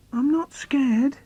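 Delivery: high, slow, shaky-brave reassurance; child talks himself brave in the dark; falsely brave. Acted. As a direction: insist you're not scared while clearly scared. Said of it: "So I have to say".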